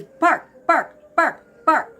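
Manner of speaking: spaced, high barks on the word; imitated dog bark. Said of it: "the dog was barking in the living room".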